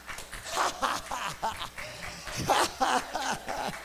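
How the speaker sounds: long, soft rolling laugh; joyful laughter. Real, not acted. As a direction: laugh with overflowing joy. Preacher overcome with joy.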